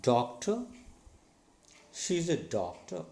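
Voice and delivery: "doctor" with firm DOC and light ta; first-syllable stress. Shown as a demonstration. Teacher has learners repeat, "doctor" stressed on DOC. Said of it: "Say after me".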